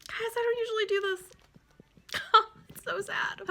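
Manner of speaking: high, choked-up voice; real crying, losing composure. Real, not acted. Reader moved by tulips spelling I love you, tearful. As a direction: squeak the words out through tears. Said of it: "trying to compose myself".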